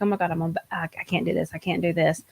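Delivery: imitating a scared whisper before a ride; hushed, imitating panic on a roller coaster. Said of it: "you're scared and it's like".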